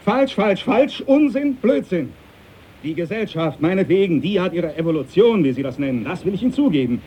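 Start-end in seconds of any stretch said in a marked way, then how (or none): 0.0-2.1 sharp, higher, rising outburst of no; indignant captain spitting out his denials; angry rejection. Acted. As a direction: fling each falsch back at him. Captain furiously rejects Darwin's idea.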